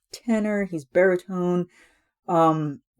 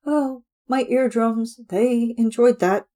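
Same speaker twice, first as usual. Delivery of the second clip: higher, slowed, mock-pained praise; sarcastic mock delight; 6 semitones higher than usual. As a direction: pretend the noise was a treat. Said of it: "And I'm like".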